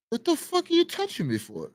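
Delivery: high-pitched retold outburst; squeaky indignant replay of the yell; mock angry yell. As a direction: throw it at him like an indignant shout. Retold confrontation, yelling at stranger.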